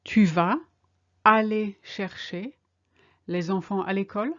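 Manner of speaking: in chunks with pauses, a rising end; slow, chunked. Shown as a demonstration. Teacher modelling the question for learners in slow chunks. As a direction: give it piece by piece. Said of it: "Are you going to collect the kids from school?".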